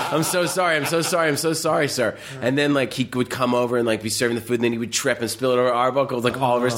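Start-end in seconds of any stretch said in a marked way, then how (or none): 0.1-2.2 high fake-sorry apology; higher, repeated hammed-up apology; apologetic waiter. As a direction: grovel in a mock servant voice. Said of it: "He was like".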